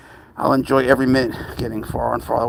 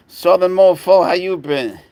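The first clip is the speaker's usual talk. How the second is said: mock Southern greeting; 7 semitones higher, 6 dB louder than usual. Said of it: "Don't I sound Southern".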